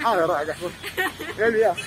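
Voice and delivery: normal talking voice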